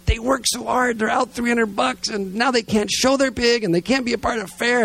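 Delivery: high-pitched indignant rant, retold; angry rant. Dad stomping around over the pig fair, hammed-up angry rant. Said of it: "I am just angrier than angry, and I'm just stomping around going".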